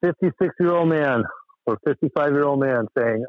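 normal talking voice

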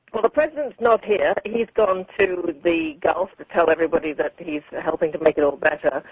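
ordinary talk

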